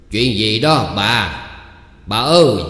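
slow, drawn-out call to his wife, rising in volume; loud calling reply. A narrator's reading. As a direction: holler to someone in another room. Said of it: "nên ứng tiếng hỏi lớn".

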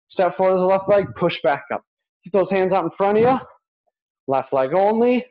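ordinary talk

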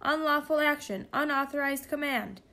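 high-pitched alarmed machine; mechanical computer voice. A narrator's reading. Stolen computer protests from the backpack, mechanical voice. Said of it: "came the mechanical voice from the backpack".

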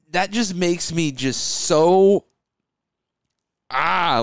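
ordinary talk, nothing marked

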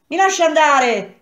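high, loud cry to be let go. A narrator's reading. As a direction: shout it urgently, pitch leaping up. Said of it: "ella gridò".

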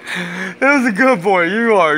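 slow, high doggy-praise voice; praising his dog, slow high pet talk. Real, not acted. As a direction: coo praise at the dog slowly.

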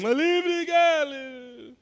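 high, slow exclamation; high drawn-out dismissal; dismissive. Real, not acted. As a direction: toss it off, drawn out and loud.

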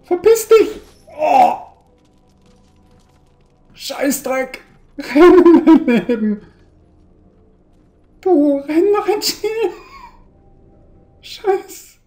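high, loud terrified shrieks; panicked screaming. Real, not acted. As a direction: scream in real terror. Monster appears, screaming in panic.

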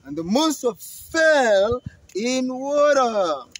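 proudly vouching; excited goat. Acted. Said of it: "the middle brother was like".

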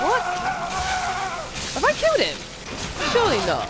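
high, loud shout of disbelief; shocked shout. Real, not acted. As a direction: yell it in sudden disbelief. Sudden enemy kill.

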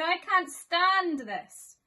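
slow, high, loud wail of despair; slow exasperated groan of a tired dad bear; exasperated Mister Bear. Acted. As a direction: drag it out like a bear at the end of his rope. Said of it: "said Mister Bear".

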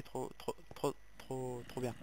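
stuck repeating "trop" before "bien"; stuttering. Real, not acted. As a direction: trip over "trop" again and again. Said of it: "Excusez-moi, j'ai bégayé". (bégaiement sur "trop, trop, trop, trop bien")

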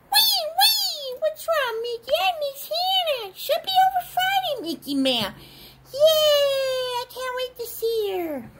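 squeaky high comforting; high toy voice. Pretend. Toy dialogue.

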